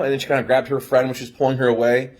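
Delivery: ordinary talk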